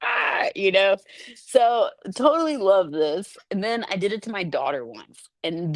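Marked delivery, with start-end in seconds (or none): 0.0-0.5 sudden very high mock scream; startling high "ah" yelp; scare shout. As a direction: jump out shrieking to scare. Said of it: "I'm just gonna be like".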